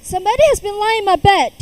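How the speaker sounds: loud, fast, high booming bear; great huge bear voice. A narrator's reading. Father bear finds his bed disturbed, in a big booming voice. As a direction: boom it out like a huge papa bear. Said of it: "in his great huge voice".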